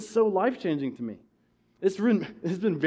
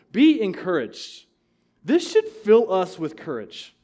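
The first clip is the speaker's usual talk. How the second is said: slow exhortation; 4 dB louder, slower than usual. Real, not acted.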